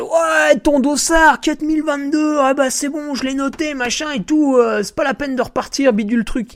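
volunteer yelling about the bib number, high imitating voice; mock yelling volunteer. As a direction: squawk the bib number back at him. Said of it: "Et il gueule".

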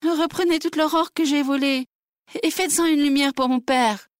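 put-on high, begging girl's voice; kneeling girl imploring the birds; pleading. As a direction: beg the birds on your knees. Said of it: "les supplia".

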